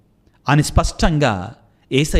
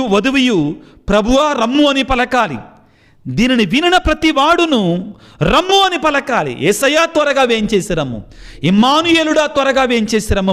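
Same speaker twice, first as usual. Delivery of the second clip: higher, urgent preaching voice; fervent preaching; 7 semitones higher than usual. Acted. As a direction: call the flock to pray for his coming. Preacher urging, reading scripture. (ఉత్సాహంగా బోధించే స్వరం)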